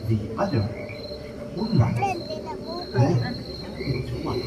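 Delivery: documentary show narration. A narrator's reading. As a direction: draw the audience into the night, slowly. Show narration over music.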